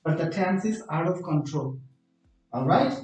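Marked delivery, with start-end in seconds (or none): none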